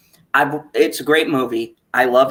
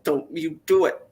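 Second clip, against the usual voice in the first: pleading with the screen; 3 semitones higher than usual. Retold or imitated. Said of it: "I'm like".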